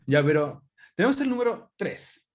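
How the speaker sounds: ordinary talk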